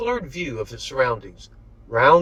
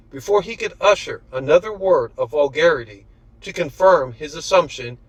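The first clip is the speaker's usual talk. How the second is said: ordinary delivery, nothing marked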